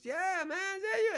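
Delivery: very high falsetto greeting from a tough guy; high squeaky reply. Retold or imitated. Bodyguard greeting an old friend, in a high squeak.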